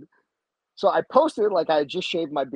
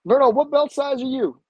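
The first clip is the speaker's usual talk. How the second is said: higher, louder instructor question; imitating instructor; 10 semitones higher, 7 dB louder than usual. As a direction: call out the question like the professor. Instructor asks belt size.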